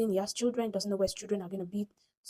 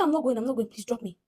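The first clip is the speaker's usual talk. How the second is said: louder, higher retold protest; insistent refusal; 4 semitones higher, 6 dB louder than usual. Passenger tells driver she wants out, urgently insistent.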